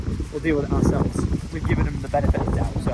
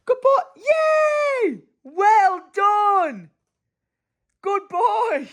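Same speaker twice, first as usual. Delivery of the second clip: high, loud delighted shouting; real proud-parent cheers; excited cheering; 5 dB louder, much slower than usual. Real, not acted. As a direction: cheer him on like he just scored. Cheering baby's first steps.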